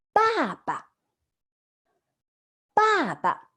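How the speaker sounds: 爸爸 said high and clear, first syllable falling, second light; teacher model word. Shown as a demonstration. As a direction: model 爸爸 slowly for students to copy. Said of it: "how to say father in Chinese?".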